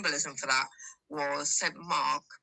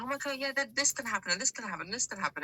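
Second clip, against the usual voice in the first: excited; 6 semitones higher, faster than usual. Retold or imitated. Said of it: "so excited".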